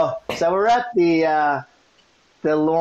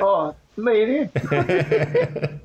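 slow, drawn-out, giggling "oh, me and him"; owning up through a nervous laugh; sheepish laughing admission; 5 semitones higher, much slower than usual. Retold or imitated. Telling the thug which one he is, laughing sheepishly.

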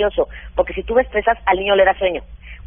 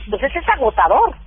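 ordinary talk, nothing marked

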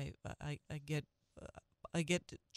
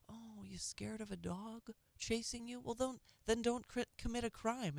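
mock taunt; 6 semitones higher, 6 dB quieter than usual. Taunting criminals.

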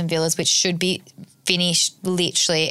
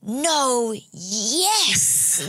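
put-on confused toddler; 6 semitones higher than usual. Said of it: "she'd be like".

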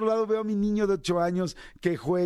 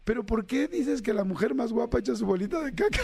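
put-on child's voice; 6 semitones higher than usual. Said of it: "Y me dice".

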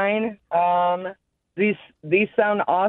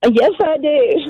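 real excitement, pitched up and louder; very excited; 5 semitones higher, 6 dB louder than usual. Real, not acted. Caller answering the radio host, very excited. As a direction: answer the host eagerly, voice lifted. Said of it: "You sound very excited today".

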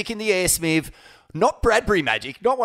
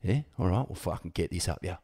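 quiet menace; 8 semitones lower, 7 dB quieter than usual. Retold or imitated. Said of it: "quietly looks at him and goes".

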